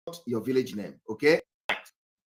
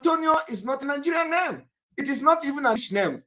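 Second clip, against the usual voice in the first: indignant shout; 7 semitones higher than usual. Real, not acted. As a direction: shout it at the student in disbelief. Lecturer berates student over name.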